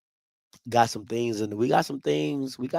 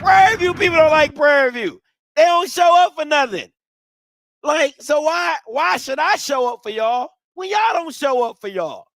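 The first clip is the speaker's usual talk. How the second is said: higher, louder ranting; loud exasperated scolding; heated rant; 9 semitones higher, 7 dB louder than usual. Real, not acted. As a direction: call the fans out hard.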